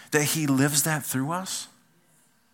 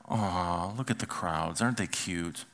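lower, softer cooing over cute crowds; dismissive coo, lower and softer; mock cooing; 7 semitones lower, 8 dB quieter than usual. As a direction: coo like at a puppy, mocking. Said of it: "he looked around and said".

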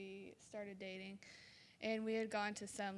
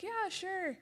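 put-on casual boyfriend; 10 semitones higher, 4 dB louder than usual. Boyfriend's easy reply.

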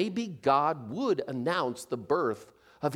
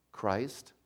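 softer and lower, barely breathed; hushed whisper; 7 semitones lower, 8 dB quieter, slower than usual. Acted. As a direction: say it under your breath in awe. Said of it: "whispered Joseph".

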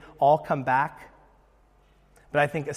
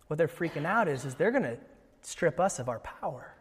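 ordinary talk

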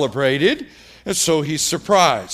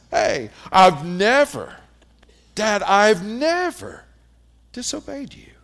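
mock perfect kid; 3 semitones higher than usual. Pastor imitating a kid claiming he never disobeyed, lightly mocking. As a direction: play a smug child insisting on innocence. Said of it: "this kid says".

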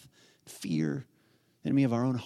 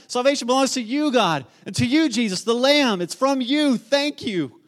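high, loud, fervent outpouring; impassioned, rushing shouts of praise; yelling worshippers; 7 semitones higher, 4 dB louder than usual. Acted. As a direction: cry out thanks to God as an exultant crowd. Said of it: "They're yelling".

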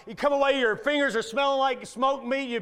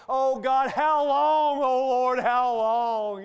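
louder pleading cry to God, voice raised; a pained psalm cry, how long; anguished prayer; 3 semitones higher, 5 dB louder, much slower than usual. Retold or imitated. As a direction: beg heaven like you're sick in bed. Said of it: "I was praying, like, Psalm thirteen".

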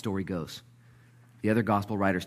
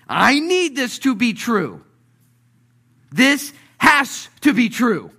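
slow, high, very loud plea; desperate shout; 12 dB louder, slower than usual. Acted. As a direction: cry it out, stretching every word. Peter desperate for it to be true.

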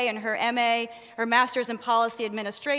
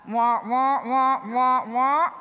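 Peanuts grown-up trombone voice; loud wah wah like muffled adults; muffled wah wah talk; 5 dB louder, slower than usual. Retold or imitated. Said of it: "parents and the teachers are saying in a Peanuts movie".

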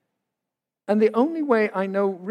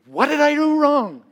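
alarmed; 8 semitones higher, 7 dB louder than usual. Retold or imitated. New husband alarmed at bride's tears.

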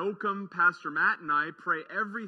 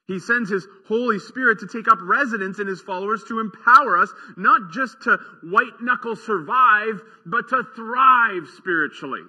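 louder, faster preaching push, words tumbling out; fervent preaching; 3 semitones higher, 8 dB louder than usual. Acted. Pastor urging his church toward thriving, fervent and loud. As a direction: drive the promise home with rising fervor. Preaching builds to full force.

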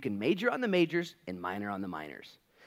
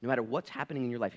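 ordinary delivery

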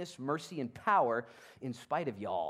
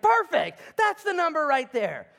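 high and much louder, triumphant; delighted boom; 12 semitones higher, 10 dB louder than usual. Acted. God answering Gideon's count, triumphant and loud. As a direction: proclaim it with glee.

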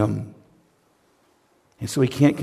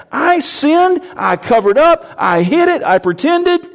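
loud, high outpouring; fervent David; high impassioned confession; 7 dB louder than usual. Acted. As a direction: pile the confessions up.

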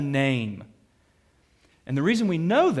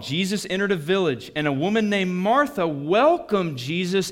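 scripture reading; 6 semitones higher, 4 dB louder than usual. Acted. Reads scripture aloud.

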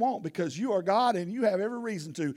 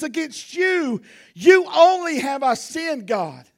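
slow, higher confessing plea; slow earnest confession; 6 semitones higher, 6 dB louder, slower than usual. Acted. David confessing his sin to God, slow and earnest. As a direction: lean into each word like a confession. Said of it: "He says".

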